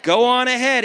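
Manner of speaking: preacher's emphatic repeat; call-out. Shown as a demonstration. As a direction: drive it home with full voice. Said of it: "Somebody shout".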